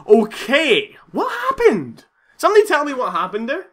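high, crying-out bewilderment; distressed cry. Real, not acted. Wailing confused shout. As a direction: gamer asking viewers what killed him, distressed.

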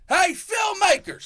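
long high holler. Retold or imitated. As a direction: call out slowly across the yard, way up high. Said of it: "we hear one guy goes".

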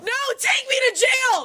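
real high-pitched, hysterical shout; worked-up shouting. Real, not acted. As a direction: scream it in defiance. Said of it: "Calm down".

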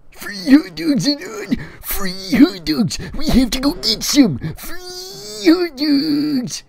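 very high, loud, stretched cries; excited character shout. Acted. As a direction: yell it like a thrilled kid. Eric excited about free hot dogs.

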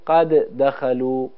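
"قد دخلوا" with two separate dals, the first released before the second; both dals pronounced. Shown as a demonstration. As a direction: say "قد دخلوا" heavily, sounding each د on its own. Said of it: "یه مقداری سنگین هست، باید بگیم".

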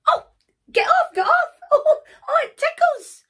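very high, loud, squealing; tickled panic. Acted. Pony with a flea on his nose, tickled squealing.

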